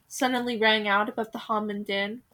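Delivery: usual speaking voice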